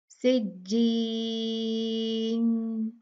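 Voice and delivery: सिज्जीन with the ई held for four counts; four-count recitation of सिज्जीन; four-count madd. Shown as a demonstration. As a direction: medium stretch on the ई of सिज्जीन. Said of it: "फिर चार".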